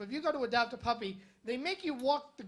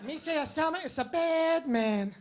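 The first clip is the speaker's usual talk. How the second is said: slow, louder, silly alien voice; Jar Jar Binks impression; 4 semitones higher, 5 dB louder than usual. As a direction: do a goofy Gungan. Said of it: "hanging out with Jar Jar Binks".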